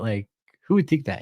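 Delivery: ordinary talk, nothing marked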